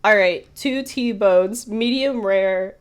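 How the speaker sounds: lightly voiced waitress, spacing out the order; mock brusque waitress. Waitress to two lawmen taking their order, mock brusque.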